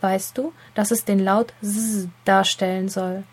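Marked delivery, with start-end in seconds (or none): 1.6-2.1 S as a held "zzz" buzz; S voiced as the sound z, not the letter name. Shown as a demonstration. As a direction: buzz the S like a bee. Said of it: "summend, wie das Summen einer Biene".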